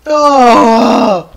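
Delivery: long, falling wail of defeat; anguished groan. Real, not acted. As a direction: moan out in despair.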